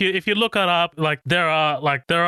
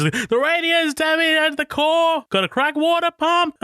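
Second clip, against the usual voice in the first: imitating the shouting mechanic; 13 semitones higher than usual. Podcast host imitating the mechanic shouting the rig report, high mock shout. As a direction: bellow it up high like the grizzled mechanic. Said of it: "he's just shouting".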